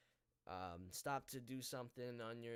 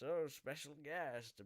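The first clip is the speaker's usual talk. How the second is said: slow, drawn-out mock announcement; mock reveal; 4 semitones higher, slower than usual. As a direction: tease it out slowly, slightly higher. Mock announcing a surprise guest.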